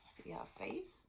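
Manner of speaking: high, hushed aside; conspiratorial whisper. Acted. Teacher whispering to kids about the angry stepmother. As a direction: whisper it like sharing a secret.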